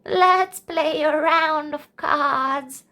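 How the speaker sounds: put-on an old lady's unhurried suggestion; gently voiced grandmother; Grandma Ruff.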